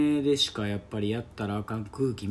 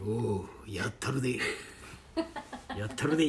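tough guy voice; 2 semitones lower, 7 dB quieter than usual. Pretend. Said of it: "キャラ変がすごい".

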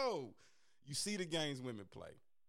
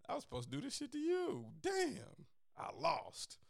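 high, then breathy defeat; mock wounded; 11 semitones higher than usual. To the girl who ghosted first, high mock defeat. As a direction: ham up losing the game. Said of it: "I was like".

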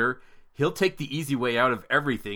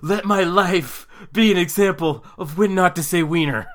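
loud, high mock-tragic plea; melodramatic father; 7 semitones higher, 7 dB louder than usual. Father offering his life as warning, overblown comic plea. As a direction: proclaim it as a hammed-up cautionary tale.

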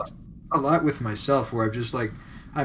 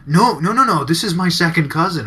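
emphatic correction; 4 semitones higher, 8 dB louder than usual. Retold or imitated. Friend correcting misunderstanding.

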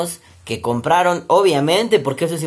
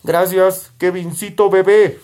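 friendly monster voice; 4 semitones higher, 4 dB louder, slower than usual. Acted. Thanking sponsor in put-on voice.

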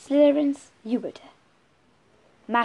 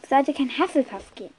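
ordinary delivery, nothing marked

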